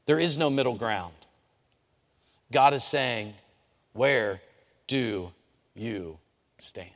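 slow, hushed preacher's challenge; solemn preaching. Acted. Preacher closing the sermon with a slow solemn challenge. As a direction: press the choice on them slowly.

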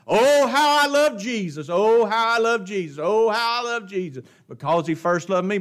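mock their repeated refrain; mimicking the religious world. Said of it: "The religious world puts all emphasis on".

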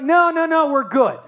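high, loud, cheery no no no; mock breezy brush-off. Fisherman brushing off the stranger on shore, hammed-up cheery brush-off. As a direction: brush off the stranger brightly, a hammed-up fake politeness. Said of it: "Do you think it was like".

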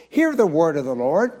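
usual speaking voice

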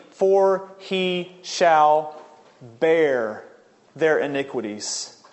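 deliberate reading, slowing right down to a weighty "bear"; emphasized scripture reading. Real, not acted. Preacher reading Isaiah to point out the burden, emphatic. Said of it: "I'll try to emphasize the words".

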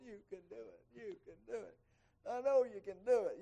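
high cheering encouragement; mock cheering. Runner cheering on a struggling friend, high encouraging voice. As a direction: cheer them on as they crawl.

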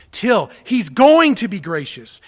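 loud, punched "going"; emphatic insistence. Shown as a demonstration. Preacher showing how until should be translated, loud emphatic certainty. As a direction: drive it home loudly, landing hard on "going".

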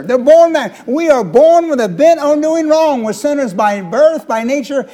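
high, loud, fervent preaching at full force; a preacher rising to a fervent, loud climax; impassioned preaching. Acted. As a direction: drive the point home to the congregation. Preacher builds to a loud peak.

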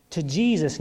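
ordinary delivery

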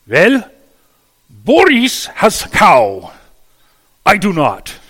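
high, loud, exaggerated Russian peasant. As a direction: belt it out like a desperate peasant. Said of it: "And Ivan thought for a moment and said".

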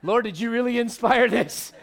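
a hint of an offended Peter, breaking into a laugh; light mock grumble of a slighted apostle; mock indignant Peter. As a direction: play Peter lightly put out, half laughing. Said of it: "And Peter's like".

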